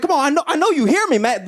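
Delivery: a beggar losing patience, pushier now; upset pleading. Retold or imitated. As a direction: push harder, getting annoyed. He starts getting upset.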